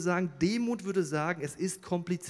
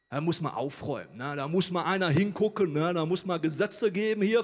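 put-on indignant moralizer, growing louder; 5 dB louder than usual. Preacher mimics Christians demanding laws, mock indignant. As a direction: build up a hammed-up demand for order. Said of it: "wie peinlich".

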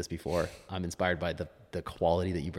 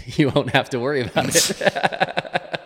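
slow words through laughter; laughing; 7 semitones higher, 11 dB louder, slower than usual. Real, not acted. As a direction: laugh while finishing the joke.

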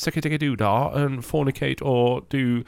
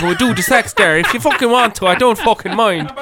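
hammed-up Irish accent, much higher and louder; 12 semitones higher, 6 dB louder than usual. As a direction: play a loud, over-the-top Irishman. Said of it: "I am allowed to talk like this being Irish".

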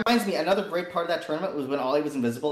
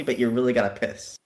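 usual speaking voice

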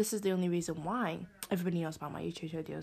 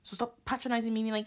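normal talking voice

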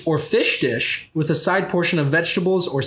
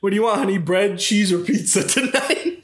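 mock waiter; 2 semitones higher than usual. Waiter voice offering dinner.

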